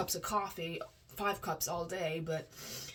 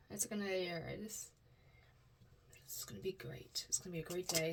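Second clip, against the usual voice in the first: whispered reassurance; 9 dB quieter, faster than usual. Real, not acted. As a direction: whisper it as a private promise.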